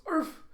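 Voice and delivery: high-pitched "arf" yelp; dog bark. A narrator's reading. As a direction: imitate a dog's short, high bark. Dog Bandit barks in story.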